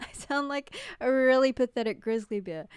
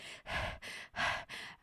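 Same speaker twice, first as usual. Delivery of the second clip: acted heavy, breathy puffs; out-of-breath panting; 8 dB quieter than usual. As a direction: mimic panting after rushing home. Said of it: "out of breath".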